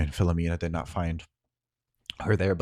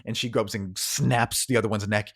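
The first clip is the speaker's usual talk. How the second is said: ordinary delivery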